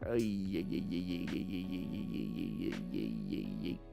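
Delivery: low, muted string of ay-ay-ay; dismayed ay-ay-ay. Real, not acted. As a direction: let the ay-ay-ay sink low under your breath. Dismayed at a friend's crash story.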